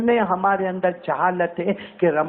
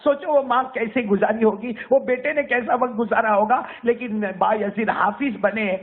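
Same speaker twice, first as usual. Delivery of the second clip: ordinary delivery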